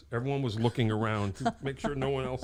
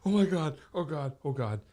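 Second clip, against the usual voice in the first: mock panic; 6 semitones higher than usual. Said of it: "you're like".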